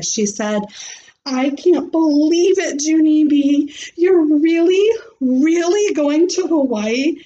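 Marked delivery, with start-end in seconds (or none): 1.3-7.2 higher, louder, astonished friend; amazed. Acted. Shirley hearing Junie B is going to Hawaii, amazed. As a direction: gasp out the disbelief.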